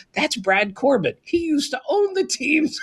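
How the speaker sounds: rising to a high laughing shout; incredulous reply. Retold or imitated. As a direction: blurt out who he is, amazed. Retold reply from Rebo.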